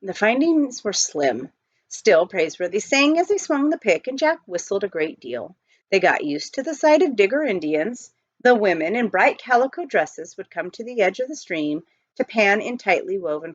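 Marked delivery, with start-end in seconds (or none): none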